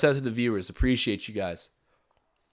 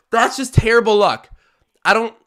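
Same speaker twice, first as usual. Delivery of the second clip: exasperated outcry; 7 semitones higher, 8 dB louder than usual. Real, not acted. Groaning at a bad-luck play.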